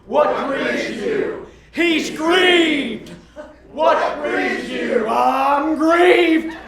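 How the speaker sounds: high, loud, slow church call and answer; call and response preaching. Pretend. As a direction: lead the congregation chant. Pastor leads call and response.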